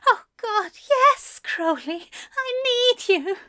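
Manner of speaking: high, breathy strained voice; breathless and strained. Acted. Aziraphale begs breathlessly.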